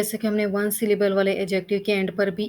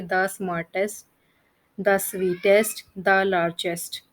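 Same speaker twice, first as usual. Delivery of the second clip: very slow, each the smartest spelled out; slow example reading; much slower than usual. Shown as a demonstration. Teacher dictating superlative examples to students, very slowly. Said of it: "हम हमेशा the".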